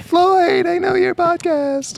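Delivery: very high, slow squeaky fan. Retold or imitated. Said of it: "It's like".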